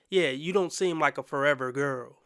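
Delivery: normal talking voice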